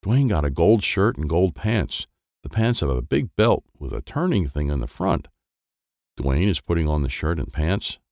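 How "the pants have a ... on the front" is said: usual speaking voice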